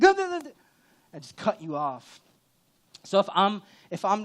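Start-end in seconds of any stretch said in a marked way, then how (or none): 0.0-0.5 loud high interrupting no's; cutting someone off. Retold or imitated. Pastor mocking himself cutting people off, loud and high. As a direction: burst in over them, loud and high. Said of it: "I'll just be like".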